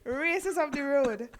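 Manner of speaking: high, loud, breaking into laughter; helpless burst of laughter while telling it; laughing. Real, not acted. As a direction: laugh hard through the words. Laughing at her sister's panicked reply.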